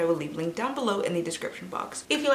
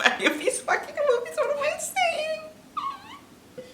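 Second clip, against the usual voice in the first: laughing at herself; slower than usual. Real, not acted. Laughing at own slip.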